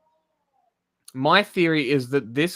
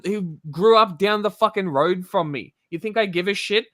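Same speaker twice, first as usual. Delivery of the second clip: ordinary talk, nothing marked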